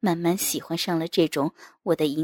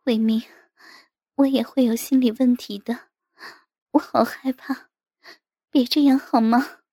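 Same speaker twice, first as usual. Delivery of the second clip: high, louder, tearful plea; fearful pleading wife; 6 semitones higher, 8 dB louder than usual. A narrator's reading. Frightened wife begging her husband to stop, pleading. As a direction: plead anxiously in a wife's higher voice. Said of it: "她扭着身体低声说".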